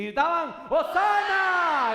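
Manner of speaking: very high, long-held shout of Hosana; full shout. Shown as a demonstration. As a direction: bellow it to rouse the congregation. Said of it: "Grita otra vez".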